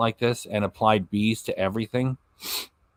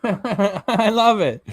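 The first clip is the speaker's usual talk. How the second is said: slow words through laughter; laughing; 7 semitones higher, much slower than usual. Real, not acted. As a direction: laugh while saying it, drawn out. Laughs at thumbnail.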